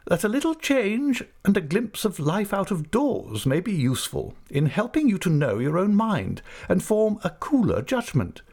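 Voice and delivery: stern aunt. Acted. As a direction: play the decisive old aunt. Aunt speaks, voiced high.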